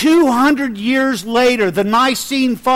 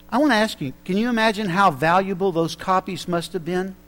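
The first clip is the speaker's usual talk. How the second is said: usual speaking voice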